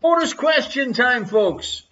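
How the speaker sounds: usual speaking voice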